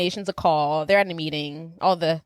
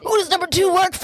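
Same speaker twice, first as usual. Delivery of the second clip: high, loud movie-quote yell; acted interrogation shout; 10 semitones higher, 4 dB louder than usual. Quoting Austin interrogating the assassin, high acted shout.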